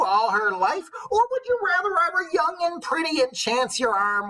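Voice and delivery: put-on high, loud, slow old crone; old wife's choice. The old wife poses her choice, high and slow. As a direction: lay out the choice like a witch. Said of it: "she lays a choice on him".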